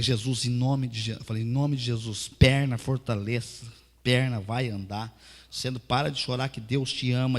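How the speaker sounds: slow, loud preaching prayer; fervent loud prayer. Acted. Pastor praying loudly over the crippled woman, fervent. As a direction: cry it out over her legs.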